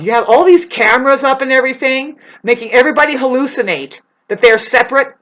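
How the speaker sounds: heated loud telling-off; angry scolding. Acted. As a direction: berate them in a high, loud voice. Scolding imagined beings.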